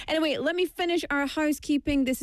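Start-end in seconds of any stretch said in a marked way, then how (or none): none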